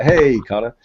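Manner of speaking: normal talking voice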